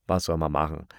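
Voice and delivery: ordinary delivery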